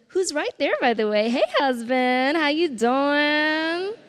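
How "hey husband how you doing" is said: slow, loud, playful hello to husband; drawn-out cheeky hello; sweet greeting. Real, not acted.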